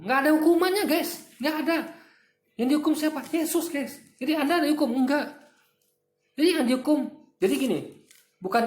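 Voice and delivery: heated. Real, not acted.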